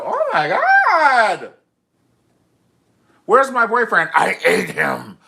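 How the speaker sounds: very loud, high hammed-up panic; mock horror scream. Partner finding the boyfriend has transformed, mock horror scream. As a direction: scream it in melodramatic horror, first words drawn out.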